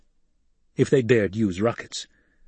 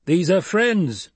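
slow, loud and higher call; 8 semitones higher, 3 dB louder, much slower than usual. Acted. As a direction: call out to the men. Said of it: "Gurney called".

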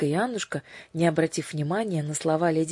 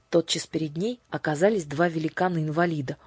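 ordinary delivery

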